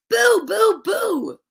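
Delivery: wailed high scary boos; finally spook the bat; high ghostly boo. Acted.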